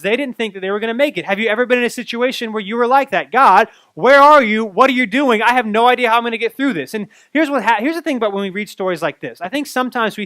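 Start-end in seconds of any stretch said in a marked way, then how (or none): 3.3-6.9 desperate cry. Retold or imitated. Panicked prayer in a storm.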